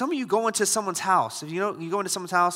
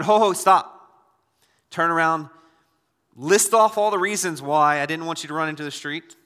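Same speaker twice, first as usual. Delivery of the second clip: loud, hammered-out parental demands; mock stern parent; 6 dB louder than usual. Preacher acting a parent making a child earn re-entry, loud and stern. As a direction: lay down the law to a child.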